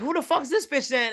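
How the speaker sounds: mock comedian roast. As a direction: fire it off like a stand-up going for the throat.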